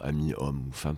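ordinary talk

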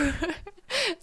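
short high giggle; embarrassed laugh. Real, not acted. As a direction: break into a quick sheepish giggle. Still laughing at herself.

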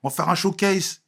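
high coaxing friend impression. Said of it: "rejoindre le doc gynéco. Il m'appelle".

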